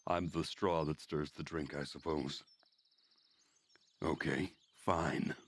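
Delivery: ordinary delivery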